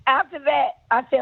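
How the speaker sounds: ordinary delivery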